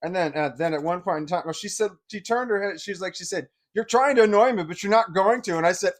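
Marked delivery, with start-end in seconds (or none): 3.7-5.6 louder, higher cutting retort; woman tells the boy she won't be baited; irritated snap. Retold or imitated. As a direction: shut him down, annoyed. She snaps at him.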